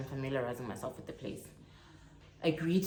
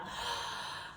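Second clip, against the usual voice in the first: gasp; 10 dB quieter than usual. Real, not acted. Reacts to Johannesburg news.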